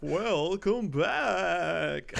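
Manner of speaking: loud, stretched-out YouTuber welcome. Shown as a demonstration. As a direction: draw out the hype greeting slowly. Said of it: "Every time I have to say".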